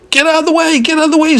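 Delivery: very high-pitched furious squawk; hammed-up shrieking driver; angry scream. A narrator's reading. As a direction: scream at someone in the road. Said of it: "screamed the man".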